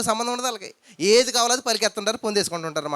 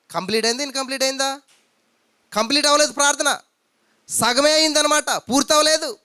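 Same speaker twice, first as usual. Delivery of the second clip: preaching; 5 semitones higher, 4 dB louder than usual. Acted. Preacher addresses the congregation.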